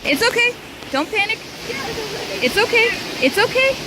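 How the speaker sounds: soothing. Real, not acted. Calming a startled animal, high voice.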